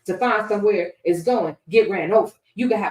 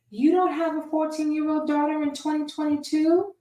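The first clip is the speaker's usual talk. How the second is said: higher, prim churchy lady asking a pointed question; Bible Lady voice; 6 semitones higher than usual. Acted. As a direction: quiz him like a know-it-all church lady. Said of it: "that's how Bible Lady talks".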